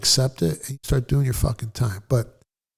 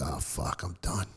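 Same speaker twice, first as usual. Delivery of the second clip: muttered dread; 4 semitones lower, 13 dB quieter, slower than usual. Retold or imitated. Dread on seeing the cops.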